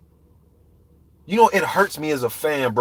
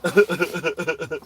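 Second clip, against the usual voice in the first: mocking fake chuckle; dopey put-on chuckle; mock laugh. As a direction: laugh along at a lame joke. Mocks fighters joking about age.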